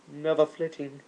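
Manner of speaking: ordinary delivery, nothing marked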